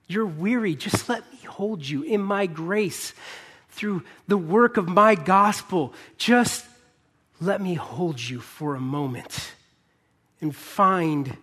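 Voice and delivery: slow, drawn-out, pleading invitation; Jesus' gracious invitation. Acted. Jesus inviting the weary to come and rest, slow and tender. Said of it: "And he's saying".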